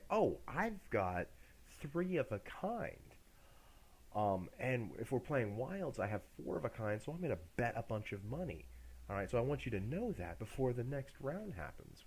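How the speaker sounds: mock clueless poker player. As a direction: play the fool who shows his hand. Mocking a foolish poker player.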